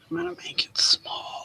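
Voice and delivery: breathy whisper under her breath. Real, not acted. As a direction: whisper it to herself while concentrating. Quietly working on a craft.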